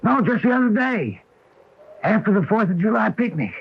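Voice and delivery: high, loud insistence; emphatic protest. Acted. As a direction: protest loudly in bewilderment. Contradicts her, insisting it was recent.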